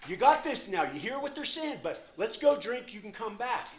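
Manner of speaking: high, loud, wheedling tempter; coaxing inner voice. Retold or imitated. The addict's inner voice urging him back to the bar, loud and high. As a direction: sell the drink like a pushy buddy. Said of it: "Voice was like".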